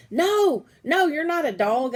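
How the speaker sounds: high, loud no, no; excited protest. Acted. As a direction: stop him, alarmed. Arguing with little brother.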